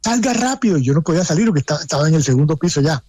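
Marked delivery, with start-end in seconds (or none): 0.0-0.8 retold shout. Son outside urges father to leave the clinic, high mock shout. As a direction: echo the son urging his father out. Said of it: "me gritaba".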